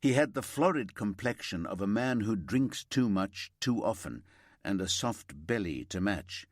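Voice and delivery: plain reading voice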